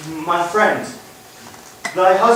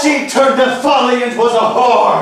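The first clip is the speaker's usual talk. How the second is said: angry; 6 semitones higher, 4 dB louder than usual. Acted. Heated quarrel.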